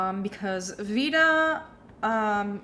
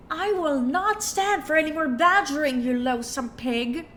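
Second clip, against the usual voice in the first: haughty socialite, louder and higher; Jennifer Coolidge voice; 5 semitones higher, 3 dB louder than usual. Acted. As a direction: scold him like a pampered heiress. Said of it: "my bad Jennifer Coolidge impression".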